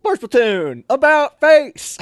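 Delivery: very high, loud, slow drawn-out order; drawn-out bellowed command; drill command. Retold or imitated. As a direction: stretch it out like a parade-ground bark. Drill sergeant barks command.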